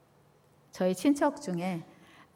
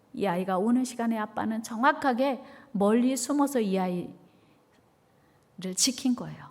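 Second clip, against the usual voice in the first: ordinary talk, nothing marked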